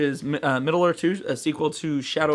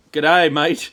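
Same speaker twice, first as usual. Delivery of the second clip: put-on Australian accent; 7 dB louder than usual. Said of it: "a good Hugh Jackman accent is".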